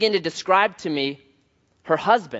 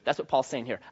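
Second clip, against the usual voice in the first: ordinary delivery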